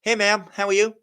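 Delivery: playful American accent demo. Shown as a demonstration. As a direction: greet her in a hammed-up American voice. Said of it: "do an American accent".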